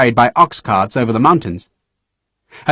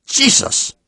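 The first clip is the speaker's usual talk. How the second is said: breathless stunned gasp of a curse; shocked exclamation; 13 semitones higher than usual. A narrator's reading. General reacts to losses, shocked. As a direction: blurt it in shock.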